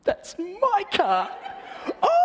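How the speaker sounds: gleeful squeal of recognition; excited. Retold or imitated. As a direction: realise it's yours and light up. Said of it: "I was so excited".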